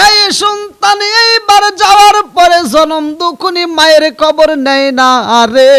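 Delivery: loud, high chanted outcry; full-force preaching. A narrator's reading. Preacher decries the neglectful son, loud high chant. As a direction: belt it out over the crowd.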